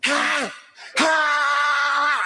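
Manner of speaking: high scornful "ha! ha!"; a high mocking double laugh. Real, not acted. Laughs at son warming up.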